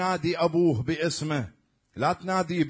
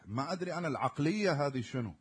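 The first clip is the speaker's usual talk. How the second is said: normal talking voice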